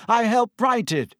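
high, emphatic retort; brisk, insistent tone; impatient. A narrator's reading. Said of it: "waving his hand impatiently".

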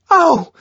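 short, loud high cry; sudden high-pitched squeal; yelp of pain. Acted. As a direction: yelp as if slapped hard. Yelps as she is slapped.

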